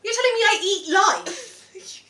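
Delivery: high, drawn-out mock outrage; mock offence. Friend reacting to the pizza quote, mock offended. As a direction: question it as if deeply insulted.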